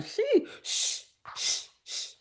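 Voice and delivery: unvoiced hushed shh; shushing. Real, not acted. Host shushing someone nearby, unvoiced. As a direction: hush them quickly.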